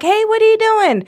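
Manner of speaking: calling out. Pretend. Imagined confronting the thief.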